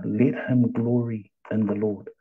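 ordinary delivery

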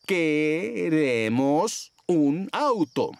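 very slow, word by word; slow, spelled-out speech. Acted. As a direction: spell it out for someone who doesn't understand. Speaking slowly to a foreigner. (muy despacio, para un extranjero)

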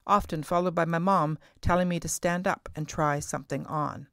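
normal talking voice

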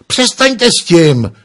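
high, loud, slow panicked screech; scream. Acted. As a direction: scream at them to stop. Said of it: "zaječel Broadman".

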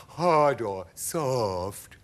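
very slow, drawn-out choice; stretched-out creature question; spider lady voice. A narrator's reading. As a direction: ask slowly as a lady spider. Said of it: "she said to James".

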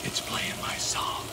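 breathy hushed whisper to himself; barely voiced, slow under-the-breath remark; whispered aside. Real, not acted. Cook whispering as his song plays, hushed. Music comes on while cooking.

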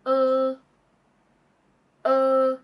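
uh held long and relaxed, like er; a long relaxed uh with a neutral mouth; neutral uh vowel. Shown as a demonstration. Tutor models the hooked O vowel, held long. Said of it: "is pronounced".

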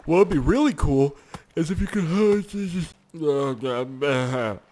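talking through a yawn. Real, not acted. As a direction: stretch it out mid-yawn. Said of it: "I yawned".

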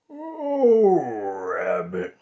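a just-woken bear's yawn. Acted. Bear waking up, answering Rabbit through a yawn. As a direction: yawn it out sleepily. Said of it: "said Bear with a yawn".

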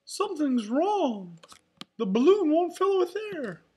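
high, loud falsetto dismay; alarmed cartoon character. A narrator's reading. Telling friends the balloon won't fill, high alarmed voice. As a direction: cry out the problem in a squeaky cartoon voice.

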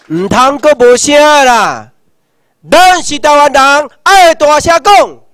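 rally speaker urging loudly; loud exhortation. Real, not acted. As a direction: rouse them to speak up.

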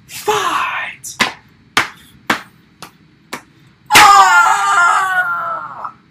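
loud high yell; high shout. Pretend. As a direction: call out the fight. Announcer then battle cry.

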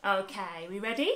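ordinary delivery

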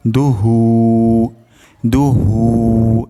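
দুহু slowly drawn, a little stretch on the vowel; slight elongation. Shown as a demonstration. Teacher shows the slight madd to students, drawn out. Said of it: "অল্প পরিমাণ টান হবে".

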